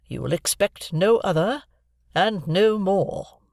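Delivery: slow, every word weighted; distinct, emphatic. A narrator's reading. Miss Havisham telling Joe the reward, distinct and emphatic. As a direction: spell it out firmly for Joe. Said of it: "in a distinct, emphatic voice".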